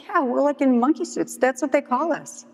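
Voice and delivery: high, matter-of-course mock coworker voice; dismissive explaining. Coworker explaining monkey suits to dealer, dismissive. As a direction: say it as if everyone knows.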